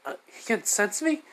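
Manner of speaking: high-pitched startled goddess voice; surprised Hestia. Retold or imitated. Hestia realizing she's been noticed, high surprised squeak. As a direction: squeak it out, caught off guard. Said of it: "which Hestia's like".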